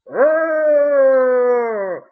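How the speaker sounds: long, high, loud drone; hammed-up zombie moan; mindless groan. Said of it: "like a bunch of".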